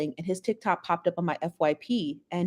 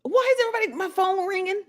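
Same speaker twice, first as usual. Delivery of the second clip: high, whiny complaint; flustered whine at a ringing phone; exasperated; 11 semitones higher, faster than usual. Real, not acted. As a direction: moan about the phone going off.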